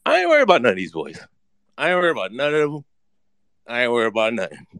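ordinary delivery

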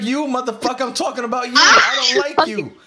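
high-pitched retold rant, building to loud; retold yelling. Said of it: "heard me yelling at the dude".

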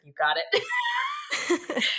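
high, laughing quoted yes; husband's laughing yes; laughing reply. Retold or imitated. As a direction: blurt it through laughter. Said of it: "he was like".

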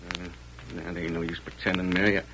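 put-on quiet hopelessness to his girl; resigned despair. Shad giving up on marriage.

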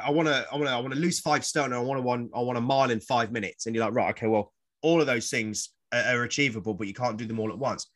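ordinary delivery

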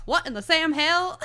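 high, loud exclaimed quote; mock yell. Fan quoting a character slipping in blood, mock yell. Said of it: "slips in blood and yells".

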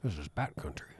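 low mumbled impression; Hunter S. Thompson impression. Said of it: "I was trying to do Hunter S. Thompson".

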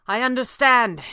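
grim call. A narrator's reading. Hero answering his partner in battle, grim call. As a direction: call back grimly over the noise. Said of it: "he called grimly".

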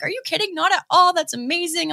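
high retold gushing; delighted reassurance. Idol approving of the tribute gear, imitated high excited reassurance. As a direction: imitate a thrilled idol, pitched well up.

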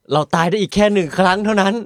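high-pitched mock desperation; dramatic solo-player panic. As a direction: play up the last-life drama. Acts out solo-play panic.

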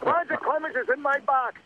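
high mock voice; imitating Susan. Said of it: "Remember that?".

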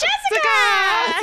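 high squealing burst of laughter; shrieking laugh. Real, not acted. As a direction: let out a delighted shriek of a laugh.

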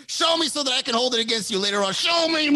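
fast, high, loud demand; mock goading. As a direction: rush it out frantically, building to a yell. Imitating date goading him to rage.

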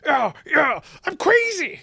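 high, drawn-out mock shout; crazed movie cop. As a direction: act out a coked-up cop losing it. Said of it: "He's just like".

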